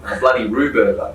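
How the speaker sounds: mock Australian accent. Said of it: "Australians sound hilarious".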